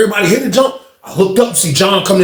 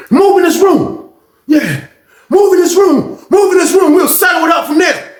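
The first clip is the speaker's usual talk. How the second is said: barked order; 12 semitones higher, 4 dB louder than usual. Retold or imitated. Inmate ordering him inside.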